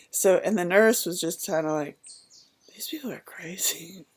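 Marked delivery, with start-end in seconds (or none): 2.7-4.1 soft unvoiced whisper; muttered aside. Nurse quietly judges the funny couple, whispered. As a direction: say it under your breath.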